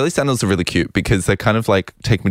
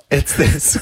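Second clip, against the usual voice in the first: ordinary talk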